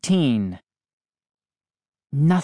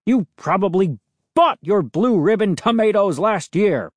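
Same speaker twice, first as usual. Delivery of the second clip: higher, scornful angry yell; roared insult; 5 semitones higher, 4 dB louder than usual. A narrator's reading. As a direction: hurl it as a jeering insult. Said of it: "he roared".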